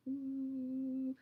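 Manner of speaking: long, level held hum; monotone drone. Shown as a demonstration. Teacher showing what monotone sounds like, droning.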